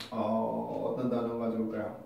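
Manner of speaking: low, soft musing to oneself; hushed low aside while searching for an example; thinking aloud. Real, not acted. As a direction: wonder quietly, drawing out the hesitation. Teacher pausing to think of example.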